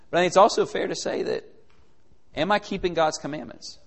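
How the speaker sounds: ordinary delivery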